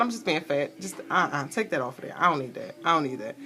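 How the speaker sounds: low, soft talking to yourself; self-talk. Retold or imitated. Woman fussing at herself while ordering at a drive-thru, low self-talk. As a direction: keep it low and under the voice.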